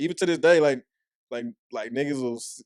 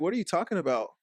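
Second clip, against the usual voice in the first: imitating baffled listeners; 5 semitones higher than usual. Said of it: "They'd be like".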